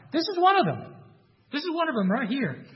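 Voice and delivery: put-on high, accusing servant girl voice. Servant girl accusing Peter before bystanders, high accusing voice.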